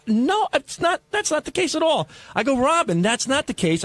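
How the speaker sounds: high-pitched protesting quote; mildly acted, high-voiced denial; retold protest. Retold pleading against her accusation.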